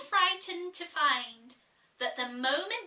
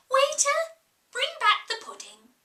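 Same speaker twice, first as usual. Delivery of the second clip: much higher and louder call across the room; 12 semitones higher, 6 dB louder than usual. Acted. Alice calling the waiter to test her own orders, loud call. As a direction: summon the waiter like giving an order. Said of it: "she called out".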